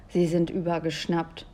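usual speaking voice

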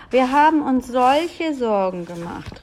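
mother's loud worried cry. A narrator's reading. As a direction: cry out in relief and worry. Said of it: "rief sie".

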